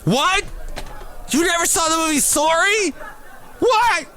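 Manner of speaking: very high, squeaky, over-the-top disbelief; hammed-up shock, as if your head will pop off; mock outrage. Imitating someone aghast he missed a movie, mock outrage. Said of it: "their heads just pop off".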